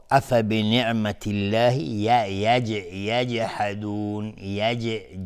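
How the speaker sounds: slow recitation, bouncing echo after ج in یجحدون; qalqala on jim. Shown as a demonstration. Teacher demonstrating qalqala, bounced ج in slow recitation. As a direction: let the ج of یجحدون rebound.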